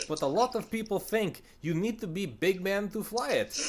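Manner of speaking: high-pitched mock Russian accent. Soviet documentary guy addressing what people think, mock Russian accent. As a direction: put on a singsong Russian accent. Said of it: "Russian guy talking about it".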